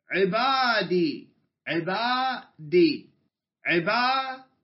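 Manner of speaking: عبادي drawn out very slowly each time; teacher repeats عبادي slowly before asking about it; slow repeated word. Shown as a demonstration. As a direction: stretch عبادي slowly so the class hears it. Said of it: "عبادي كلمة ولا كلمتين؟".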